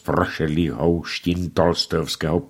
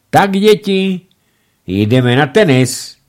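much higher and louder, rallying; energetic; 8 semitones higher, 7 dB louder than usual. A narrator's reading. Father rouses the family for tennis, energetic. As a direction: round up the children with gusto. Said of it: "řekl pan Rada energicky".

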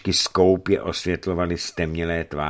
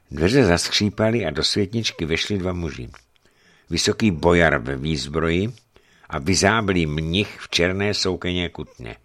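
ordinary delivery, nothing marked

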